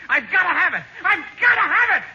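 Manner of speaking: 1 insistent. Acted. Rich man desperate for railroad.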